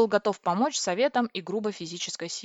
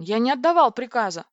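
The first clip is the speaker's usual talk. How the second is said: louder, higher indignant objection; raised-voice denial; startled protest; 4 semitones higher, 7 dB louder than usual. A narrator's reading. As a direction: protest as if suddenly alert. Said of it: "очнулся Владлен".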